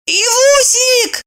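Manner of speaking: high, slow call. Acted. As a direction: call across the flat. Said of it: "крикнула Евдокия".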